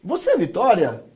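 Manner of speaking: rising question tune on "Vitória"; questioning intonation. Shown as a demonstration. Teacher showing Portuguese questions, intonation lifts on "Vitória". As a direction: make "Você é Vitória" a question by lifting the voice on "Vitória". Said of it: "A gente usa a entonação".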